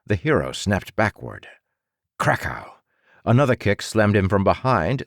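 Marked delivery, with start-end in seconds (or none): 2.2-2.8 low punchy krakow; a low crash for the blow; impact crash. A narrator's reading. Narrator voices the dragon's kick landing.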